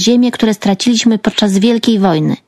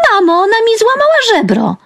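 high-pitched wailing tattle; shrieking complaint; 14 semitones higher than usual. A narrator's reading. Girl telling mother on her sister, high shriek. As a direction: wail it up high to mum. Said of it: "wrzasnęła Tatiana".

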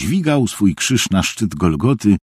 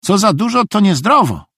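much higher, innocent voice; naive simpleton; 12 semitones higher, 3 dB louder than usual. A narrator's reading. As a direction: offer it as simple folk wisdom, high. Narrator voices naive Szwejk.

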